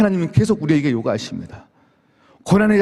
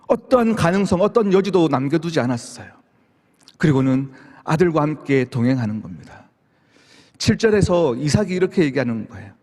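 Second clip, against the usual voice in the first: normal talking voice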